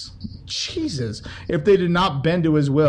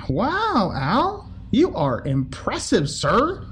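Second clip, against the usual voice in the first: higher, fake wide-eyed admiration; sarcastic awe; 6 semitones higher, much slower than usual. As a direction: gush at Al, not meaning a word. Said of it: "Just kidding".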